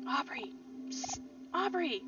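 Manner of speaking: hissed, secretive call for attention; stage whisper. Acted. Calling to Aubrey in the pew during a sermon, stage whisper. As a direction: get someone's attention without being overheard. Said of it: "I don't know why I'm whispering".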